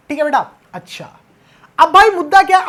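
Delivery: usual speaking voice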